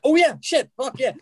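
high, loud burst of delight; excited. Retold or imitated. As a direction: reenact a fan suddenly thrilled. Retells own reaction to dad's suggestion.

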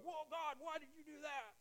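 high, soft startled whine at God; mock hurt complaint. Pastor voicing a believer's complaint to God, high mock whine. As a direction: play the wounded believer asking why. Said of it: "you go".

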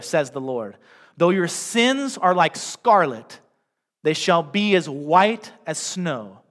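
slow, solemn Bible reading with weight on each phrase; scripture reading. Acted. Pastor reading Bible verses to the congregation, slow and solemn. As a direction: read the verses slowly and deliberately.

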